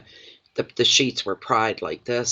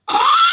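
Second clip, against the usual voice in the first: very high, loud squeal breaking into laughter; piercing happy scream and laugh; joyful scream into laugh; 6 dB louder than usual. Real, not acted. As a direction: shriek with real delight, then laugh. Overjoyed at husband coming home.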